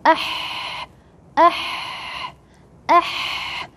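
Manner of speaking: ah, breathed from the throat, no voice; voiceless throat ah; ah with sukun. Shown as a demonstration. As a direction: practise ah from the throat. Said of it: "start to practice it with sukun".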